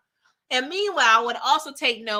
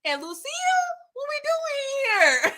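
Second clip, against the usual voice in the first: laughing plea; 14 semitones higher than usual. Real, not acted. Laughing exasperation at character.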